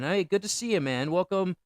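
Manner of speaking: normal talking voice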